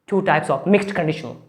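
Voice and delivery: usual speaking voice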